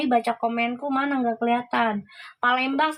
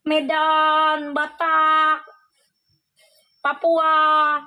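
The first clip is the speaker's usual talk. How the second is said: each name called out louder, building; animated list, each word louder; excited emphatic listing; 4 dB louder, much slower than usual. Real, not acted. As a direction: punch out each place name with rising excitement. Listing regions with harsh accents.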